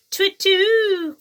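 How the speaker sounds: high, loud hooting call; owl hoot. Acted. The owl calling down to Jackal, loud hoot. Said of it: "called the owl".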